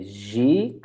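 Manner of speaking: ziek with the vowel stretched, rising; long vowel. Shown as a demonstration. Teacher showing the longer sound, ziek stretched slowly. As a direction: draw out the "ie" in ziek. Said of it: "it's a bit longer".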